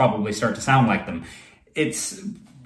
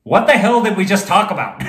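retold line broken up by a laugh, a bit higher and louder; laughing retold question; 6 semitones higher, 4 dB louder than usual. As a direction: replay the moment, cracking up. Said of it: "I turn to this person and I go".

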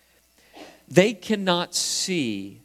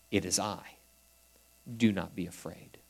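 gentle reassurance; 7 semitones lower, 9 dB quieter than usual. Acted. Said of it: "That's God saying".

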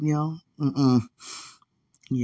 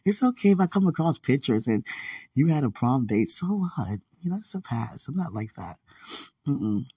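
normal talking voice

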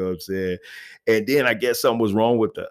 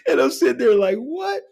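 laughing. Real, not acted. Laughing disbelief after punchline.

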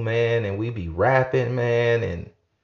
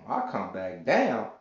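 usual speaking voice

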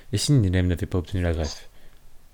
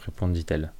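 ordinary delivery

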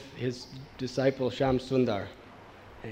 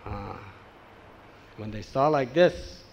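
normal talking voice

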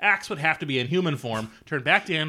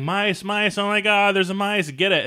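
high-pitched mock panic cry; mock screaming; 7 semitones higher, 3 dB louder than usual. Podcaster retells Darlene running from mice, mock screaming. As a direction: imitate a panicked scream, pitched up without real force.

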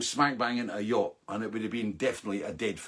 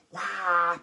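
mock hawk scream; 6 semitones higher than usual. Shown as a demonstration. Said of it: "screams like a, a hawk".

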